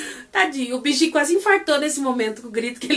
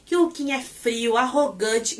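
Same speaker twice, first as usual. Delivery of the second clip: normal talking voice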